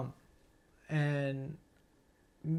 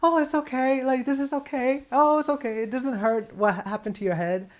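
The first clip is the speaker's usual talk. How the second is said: put-on much higher, sing-song comfort for a small child; soothing a child; 9 semitones higher, 4 dB louder than usual. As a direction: coo it like an adult distracting a hurt kid. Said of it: "like an adult, uh, trying to distract a child".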